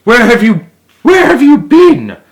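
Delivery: high, loud worried scolding; scolding grandmother. Acted. Grandmother scolds the boy returning home, high and loud. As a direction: scold him like a frantic grandmother. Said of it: "scolded Grandmother Noonie".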